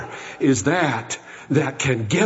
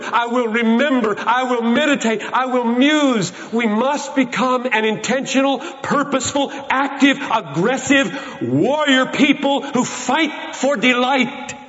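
rallying preaching; 8 semitones higher than usual. Acted. Preacher rallies the congregation to fight, rising vows. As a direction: call them to arms.

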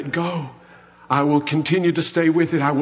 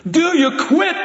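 high, loud demand; sharp challenge; 13 semitones higher, 4 dB louder than usual. Acted. Preacher challenges the congregation, loud and high. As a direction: hit them with the question.

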